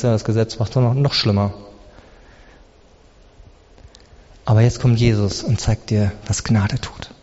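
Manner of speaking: low, soft, with long pauses; quiet intimate preaching. Acted. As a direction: draw the listeners in quietly. Leise, eindringliche Predigt. (leise, eindringliche Predigt)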